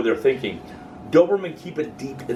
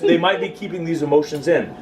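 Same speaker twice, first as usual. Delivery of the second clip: usual speaking voice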